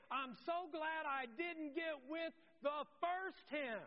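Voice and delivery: high, loud, stretched-out thanks; emphatic gratitude. Acted. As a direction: testify it with loud relief. Acted relieved, thankful quote.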